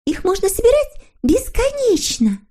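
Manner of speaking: very high, eager little voice; hopeful. A narrator's reading. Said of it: "с надеждой продолжила она".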